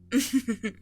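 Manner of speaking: short indulgent chuckle; brief amused laugh through closed lips. Acted. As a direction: chuckle fondly at the child. Amused by the child's reply.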